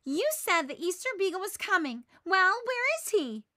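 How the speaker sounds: loud, high indignant demand; fuming. Acted. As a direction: push him for an answer, furious. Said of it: "Sally fumes".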